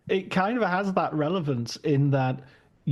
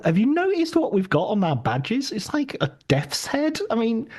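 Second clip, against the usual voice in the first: higher, louder puzzled sketch voice; comic worry; 6 semitones higher, 3 dB louder than usual. Retold or imitated. Sketch Nazi questions his comrade about their badges, comic worry. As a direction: voice the growing alarm of a comedy character.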